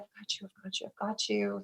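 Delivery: hushed, very soft comforting; soft reassurance. Retold or imitated. Comforting the scared birth mother, softly reassuring. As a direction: murmur it soothingly, nearly under your breath.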